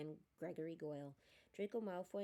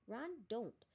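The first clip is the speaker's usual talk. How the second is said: higher-pitched cross reprimand; mildly angry, raised-pitch warning; 6 semitones higher, 4 dB louder than usual. A narrator's reading. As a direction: scold a friend sharply to stop him. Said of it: "said Hermione angrily".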